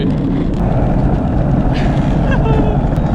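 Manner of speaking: high loud breathless laughter; excited shrieking laugh. Real, not acted. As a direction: shriek with laughter mid-race. Laughing during kart race.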